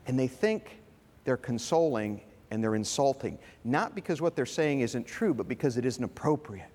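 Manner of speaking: usual speaking voice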